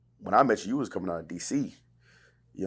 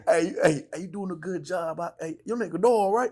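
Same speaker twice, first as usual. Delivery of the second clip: imitating his much higher, lighter voice; high friendly impression of a mentor; Akkad's voice; 10 semitones higher than usual. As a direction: mimic his high, bouncy greeting. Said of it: "he always talk like this".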